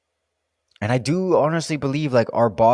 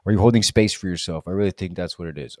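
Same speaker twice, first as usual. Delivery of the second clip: ordinary talk, nothing marked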